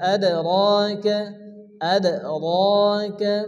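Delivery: "আদ" with a light bounce on দ, then a long "রাকা"; qalqala. Shown as a demonstration. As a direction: echo the দ of "আদ" with a small jolt. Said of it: "হালকা ধাক্কা দিয়ে পড়তে হবে".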